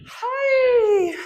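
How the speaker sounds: high loud exertion cry; effort cry. Real, not acted. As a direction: burst out on the jump. Exertion cry during squat jump.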